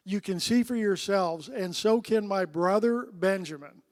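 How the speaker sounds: solemn scripture read to the congregation. Acted. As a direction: read the passage out with weight. Reading scripture aloud.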